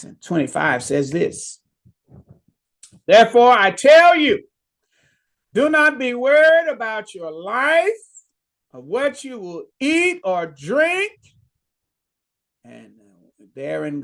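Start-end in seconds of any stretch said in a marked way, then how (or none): 3.1-11.1 proclaimed scripture. Real, not acted. Preacher reads scripture.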